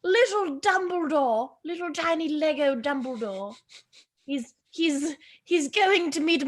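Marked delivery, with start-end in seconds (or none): none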